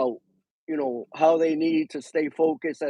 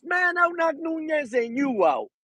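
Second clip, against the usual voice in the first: cocky boxer imitation; 9 semitones higher than usual. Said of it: "Keyshawn is saying".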